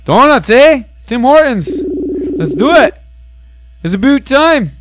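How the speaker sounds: loud, high-pitched mock Canadian; hammed-up Canadian shout-out, each phrase bouncing upward; Canadian accent. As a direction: put on a big, silly Canadian accent. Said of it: "trying to do a Canadian accent".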